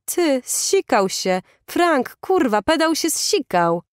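high-pitched, excited mocking call; gleeful jeering outcry. A narrator's reading. Bully alerts his mate to the victim's shame, jeering. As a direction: crow it to the gang.

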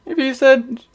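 high, loud, fast cartoon voice; high loud cartoon ranting; Scooby-Doo voice. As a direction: jabber like Scooby in a squeak. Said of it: "Scooby-Doo impression".

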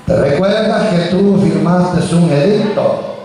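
ordinary talk, nothing marked

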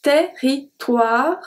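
Territoire said very slowly, oi as wa; slow pronunciation demo. Shown as a demonstration. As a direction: drag out Territoire so they hear the wa. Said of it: "is pronounced as wa".

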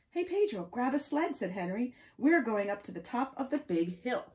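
high, loud, eager picture-book dialogue; story read-aloud. Acted. Reading Henry's sledding invitation to children, lively and loud. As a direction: call out the children's lines as you read.

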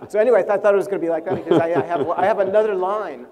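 can't stop laughing while telling it. Real, not acted. Laughing while retelling the story.

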